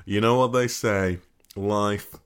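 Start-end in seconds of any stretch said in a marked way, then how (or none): 1.6-2.1 mock solemn.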